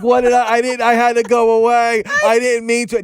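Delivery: high-pitched retold plea; mock pleading. Christopher Robin excusing going away, mock pleading. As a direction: plead it high, lightly imitating his anguish. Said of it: "And he's like".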